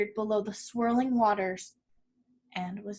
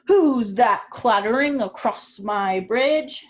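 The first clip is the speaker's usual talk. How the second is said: louder, slow troll yell from under the planks; troll scream; 8 dB louder, slower than usual. A narrator's reading. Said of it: "screamed the troll".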